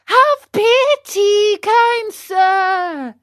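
very high, loud, drawn-out wail; high puppet begging loudly; desperate pleading. Acted. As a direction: cry out for mercy at the top of the voice. Puppet begging for friend's life.

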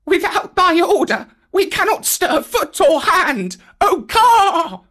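very high, loud chorus-like wail; wailing monkeys. Acted. Spellbound monkeys answering the python, very high loud wail. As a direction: cry out in helpless obedience.

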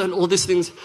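ordinary talk, nothing marked

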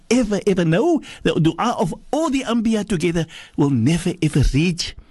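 high-pitched, emphatic preacher; impassioned preaching. Acted. Preacher driving home the prayer.

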